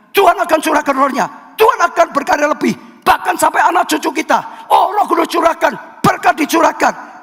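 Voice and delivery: high, shouted, fast run of proclamations; fiery preaching. Acted. Preacher proclaiming God's cleansing to the congregation, shouted. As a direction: hammer each phrase like a revival call.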